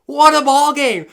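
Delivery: loud, thrilled play-by-play shout; oh my gosh bursting out; excited call. Real, not acted. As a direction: call the finish like a broadcaster. Live play-by-play call, game ends.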